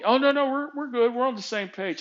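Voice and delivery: high-pitched imitation of a dismissive boss; mock reassurance. Boss insisting they are on the same page, mock reassuring.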